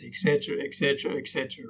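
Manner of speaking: droning, dying away; monotone. Shown as a demonstration. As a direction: drone it out on one dull note, fading. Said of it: "That's monotone".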